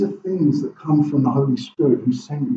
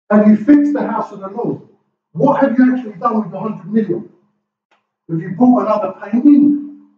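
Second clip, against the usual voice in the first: fiery preaching; 6 semitones higher than usual. Acted. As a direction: hammer the congregation with questions. Preaching at full force.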